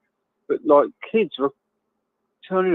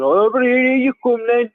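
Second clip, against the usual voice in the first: higher-pitched, hammed-up Brummie accent; 7 semitones higher than usual. Mimicking a Birmingham market seller to a friend, in a hammed-up Brummie accent. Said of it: "I'm gonna try and do a Brummie accent now".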